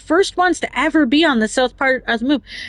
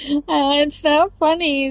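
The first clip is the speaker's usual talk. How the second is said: mock Brad; 2 semitones higher, slower than usual. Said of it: "he says".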